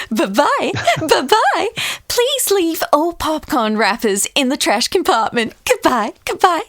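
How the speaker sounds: high, sing-song doll farewell; bright theme-park doll waving everyone out; cheery Barbie voice. Acted. Friend picks a Pixar toy and does Barbie's high chirpy goodbye. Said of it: "I'm Duergar Barbie".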